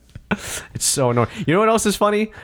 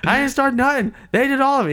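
pleading innocence; 12 semitones higher than usual. Retold or imitated. Pleading with fleeing witness.

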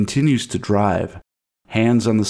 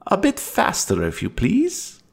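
creepy creature voice; 11 semitones higher than usual. A narrator's reading. Creature urges speed.

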